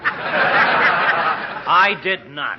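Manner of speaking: laughing off the accusation; laughing denial. Acted. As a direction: protest it while laughing. Laughing denial about the pie.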